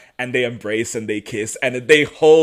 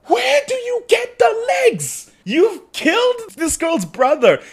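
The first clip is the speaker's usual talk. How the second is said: much higher, louder, slow incredulous cry; exasperated outburst; 4 dB louder, slower than usual. Retold or imitated. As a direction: yell at him in disbelief. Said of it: "And I'm like".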